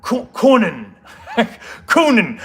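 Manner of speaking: amused practice of Cockney "cunning"; testing out a Cockney accent; British Cockney accent. Acted. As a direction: repeat "cunning" in Cockney, chuckling. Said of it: "say it with a British Cockney accent".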